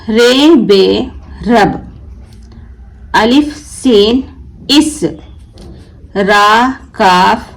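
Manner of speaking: very slow, each sound drawn out for pupils; slow teacher's reading, sound by sound; slow syllable reading. Shown as a demonstration. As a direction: stretch every letter sound as you sound the word out. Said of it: "मैं यहाँ पर हिज्जे से नहीं पढ़ रही हूँ".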